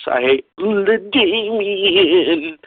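high, slow mocking drawl on LaDainian; mocking whine. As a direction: stretch the name out to mock him. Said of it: "make fun of LaDainian".

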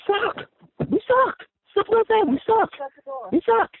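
high, strained yell of disgust; frustrated shout. Real, not acted. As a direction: cry it out like a fed-up fan at the TV. Caller ranting about his team.

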